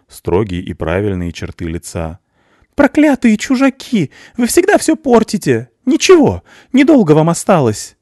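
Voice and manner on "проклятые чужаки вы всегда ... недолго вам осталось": very high, loud threatening scream; hateful scream. A narrator's reading. Mother screams threats at the strangers, very high and loud. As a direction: curse the outsiders at the top of her voice.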